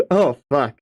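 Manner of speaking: usual speaking voice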